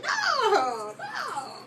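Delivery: high-pitched reenacted no; disbelieving denial. Retelling her reply to the women, incredulous denial.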